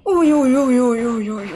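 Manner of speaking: uy repetidos, fuertes y nerviosos; tensión. Real, not acted. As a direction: se asusta mientras juega. Tensión en el juego. (nervios en voz alta)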